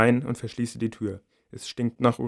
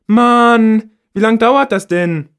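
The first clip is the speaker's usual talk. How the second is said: high, loud exasperated complaint; loud high annoyed teen voice; impatient girl; 11 semitones higher, 12 dB louder, much slower than usual. A narrator's reading. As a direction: demand to know what's taking so long.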